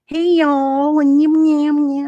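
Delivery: high, loud drawn-out holler into babble; mock an obnoxious woman coming on; hammed-up hollering and yammering; imitated loud lady. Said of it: "she was like".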